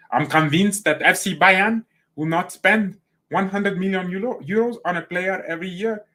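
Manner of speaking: put-on high, muddled German-Italian accent; botched German accent. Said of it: "that's not German".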